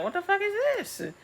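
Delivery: very high squeaky dragon voice, sniffing out a scent. Acted. Dragon Caraxes sniffing out the thief, very high squeaky voice. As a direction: play the dragon as a shrill cartoon sniffer.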